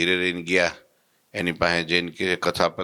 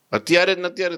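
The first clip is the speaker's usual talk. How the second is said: surprised; 11 semitones higher, much faster than usual. Retold or imitated. Surprised at the rush.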